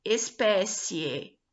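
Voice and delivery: Espécie drawn out slowly syllable by syllable; slow syllable demo. Shown as a demonstration. Teacher slowly sounding out Espécie for students. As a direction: stretch out Espécie for the class. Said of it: "Tá vendo?".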